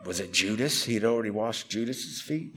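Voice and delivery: low, soft musing question; imitating someone quietly puzzling aloud; thinking out loud. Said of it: "thinking out loud".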